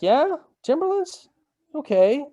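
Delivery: a dad caught off guard by the ask; playful high surprise; surprised dad. Retold or imitated. Dad reacting to son's shoe request.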